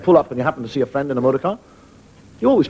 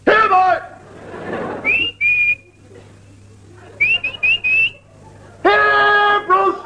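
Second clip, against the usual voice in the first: calling for the dog; much slower than usual. Retold or imitated. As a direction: yell for a runaway dog, high and desperate. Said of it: "there is the master saying".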